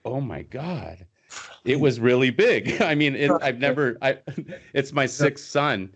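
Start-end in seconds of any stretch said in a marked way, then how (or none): 0.1-1.1 softer, slow, hushed oh my God; a hushed, slow stunned father; retold awe. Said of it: "and I'm like". 4.2-4.7 short laugh. Real, not acted. Laughing at the story.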